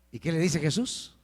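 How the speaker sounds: ordinary talk, nothing marked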